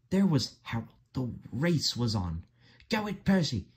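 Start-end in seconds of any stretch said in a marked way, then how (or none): 2.9-3.7 driver's mild yell. A narrator's reading. Driver urges Percy on in the race, mild yell. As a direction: cheer him on in a high voice. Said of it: "he yelled".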